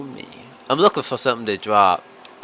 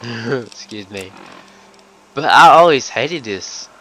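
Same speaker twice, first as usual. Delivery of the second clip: normal talking voice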